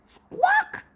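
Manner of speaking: very high squawk like a seabird; bird squawk. A narrator's reading. Princess talks to the bird in its language, high squawk. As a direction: squawk it out like a gull.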